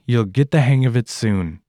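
ordinary talk

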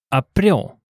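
"April" stressed on its last syllable. Shown as a demonstration. Teacher noting an exception to the rule, "April" stressed on the last syllable. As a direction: Dutch "April" with the weight on "pril". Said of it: "stress is on the last syllable".

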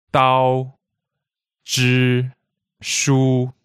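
DAO, ZHI, SHU each loud, level and held; steady flat pitch on "dao", "zhi", "shu"; first tone. Shown as a demonstration. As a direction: list "dao, zhi, shu" as separate, unchanging tones. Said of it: "we can all hear there's a certain steady".

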